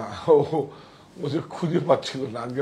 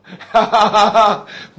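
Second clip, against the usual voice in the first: hearty laugh; 6 semitones higher, 11 dB louder than usual. Real, not acted. Laughs at a viewer's message.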